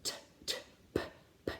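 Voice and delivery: "t" and "p" whispered, voiceless with puffs of air; voiceless aspirated consonants, demo. Shown as a demonstration. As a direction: puff out a breathy t and p. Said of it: "feel these sounds in their mouth".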